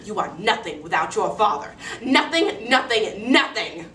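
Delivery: mildly voiced angry stepmother, emphatic; belittle, hammering the repeated last word; scornful scolding. Stepmother scolding girl for bragging, scornful and emphatic.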